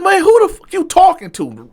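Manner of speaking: high-pitched, loud acted challenge; angry comeback. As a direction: fire back in mock outrage.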